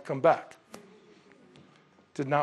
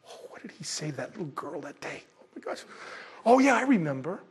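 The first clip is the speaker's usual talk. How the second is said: mumbled musing, much softer, then bright recall; a hint of Peter racking his brain; Peter trying to remember; 5 dB quieter than usual. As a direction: Peter searching his memory under his breath. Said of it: "can't you just see him going".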